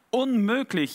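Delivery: a touch of dismay in the character's cry; wailing. A narrator's reading. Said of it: "jammerte er".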